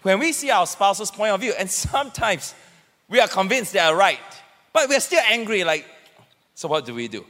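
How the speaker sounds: ordinary talk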